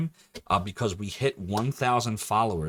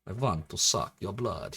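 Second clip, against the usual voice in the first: slow mock vampire voice; Dracula; slower than usual. Streamer misquoting the count for spooky Tuesday, hammy vampire. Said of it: "He never said that".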